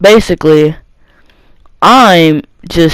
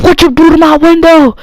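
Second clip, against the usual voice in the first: high-pitched mock yell, much higher than usual; retold yelling; 10 semitones higher than usual. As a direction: imitate an angry scolding, pitched way up. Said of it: "She starts yelling at her".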